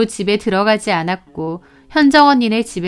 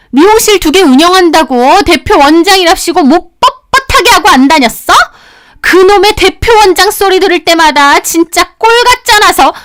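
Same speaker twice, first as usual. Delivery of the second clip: angry tirade; 6 semitones higher, 5 dB louder than usual. Acted. Sister-in-law scolding her brother's wife, furious yelling. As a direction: berate her like a furious elder.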